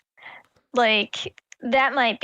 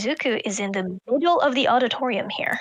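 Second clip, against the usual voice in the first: whispering; 2 semitones lower than usual. Real, not acted. Quiet aside while watching.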